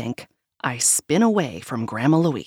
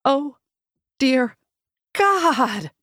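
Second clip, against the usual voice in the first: dismayed; 8 semitones higher, much slower than usual. Acted. Narrator dreads seeing ex.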